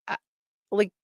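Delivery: ordinary talk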